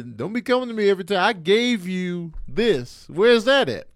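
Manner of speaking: scolding dad. Retold or imitated. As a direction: get on him like a fed-up dad. Dad scolding son about money.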